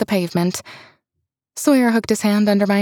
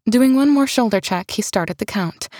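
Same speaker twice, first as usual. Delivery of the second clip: ordinary delivery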